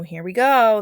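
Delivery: usual speaking voice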